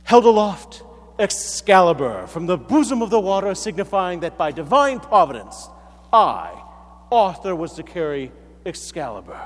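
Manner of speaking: King Arthur. Acted. Arthur proclaims his divine right to rule, in mock splendor. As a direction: declaim it grandly with measured pauses. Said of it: "And Arthur, in his splendor".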